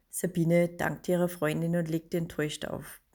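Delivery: usual speaking voice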